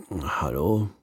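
drowsy, just-woken hello; sleepy good morning. A narrator's reading. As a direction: mumble it half asleep. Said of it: "sagt eine verschlafene Stimme".

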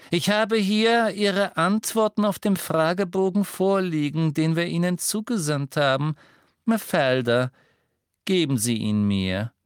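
high, slow, condescending official; Umbridge voice. A narrator's reading. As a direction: talk down to her like a stern official.

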